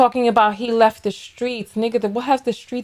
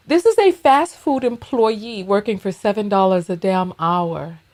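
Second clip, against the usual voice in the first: normal talking voice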